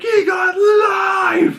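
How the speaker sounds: very high, drawn-out mock outburst; imitated yell. Imitating Frank arguing about Foggy, stretched high yell. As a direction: stretch the last word into a hammed-up shout.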